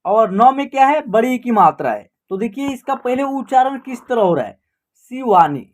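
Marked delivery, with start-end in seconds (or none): 5.1-5.7 शिवानी said briskly with a short final ई; short, simple vowels. Shown as a demonstration. Teacher showing how the name sounds, शिवानी with short vowels. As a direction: say शिवानी simply, without stretching the ई. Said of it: "इसका उच्चारण short तरीके से हो रहा है".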